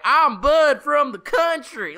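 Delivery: high, slow, loud country-bumpkin drawl; exaggerated country accent. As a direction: ham up a southern yokel accent. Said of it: "it's like a negative stereotype".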